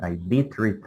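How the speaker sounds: "I did read" with did clearly stressed; emphasis on DID. Shown as a demonstration. Teacher repeating emphatic DID for students. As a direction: punch the word did. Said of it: "like with emphasis".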